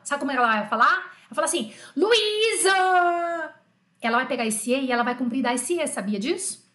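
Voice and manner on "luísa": drawn-out, raised call of an angry mum; angry mother calling. Acted. French mother cross with her daughter Luísa, angry long call. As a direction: stretch the name out, high and cross. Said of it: "ela tiver brava".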